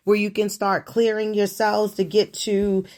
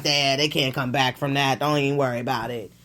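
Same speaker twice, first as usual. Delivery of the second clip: mock dismissive; 7 semitones lower, faster than usual.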